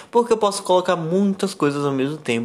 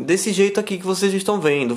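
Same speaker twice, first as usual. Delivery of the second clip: ordinary talk, nothing marked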